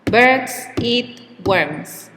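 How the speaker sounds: slow, with Birds, eat and worms each given a strong beat; three firm even beats; stressed rhythm. Shown as a demonstration. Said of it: "the time between stressed words is always the same".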